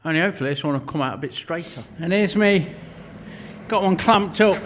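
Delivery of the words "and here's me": usual speaking voice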